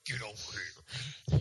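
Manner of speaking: slow, drawn-out video game villain; Scorpion voice. Retold or imitated. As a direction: drag it out like a fighting-game ninja. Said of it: "It sounded like Scorpion".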